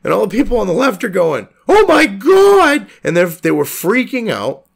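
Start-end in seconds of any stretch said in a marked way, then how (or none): 1.7-2.9 high, loud, drawn-out freak-out; slow shrill-free mock hysteria, higher and louder; mock panicked gasp. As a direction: ham up a panicking dupe. Mocking panicking leftists.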